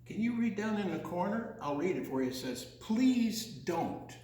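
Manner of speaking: usual speaking voice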